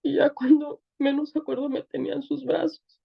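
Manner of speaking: real tears as she tells it; choked-up weeping testimony; crying. Real, not acted. Tearful testimony of being forgiven.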